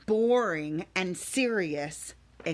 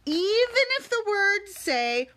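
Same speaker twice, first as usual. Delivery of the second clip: rising excitement; 10 semitones higher, 4 dB louder than usual. Acted. Building to silly word.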